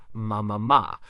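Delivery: ma, ma, ma as lightly voiced baby babble; a mild hint of a nursing baby saying ma, ma, ma; imitating baby babble. Narrator voicing a baby to its mother, lightly voiced babble. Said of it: "The mother hears the sound".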